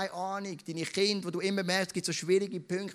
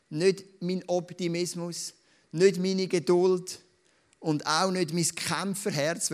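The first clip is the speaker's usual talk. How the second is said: slow emphatic list; 4 dB louder, slower than usual. Real, not acted. Pastor stresses each negation slowly.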